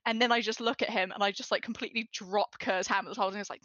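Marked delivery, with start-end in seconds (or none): none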